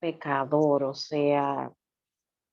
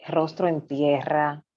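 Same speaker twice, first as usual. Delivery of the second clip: ordinary talk, nothing marked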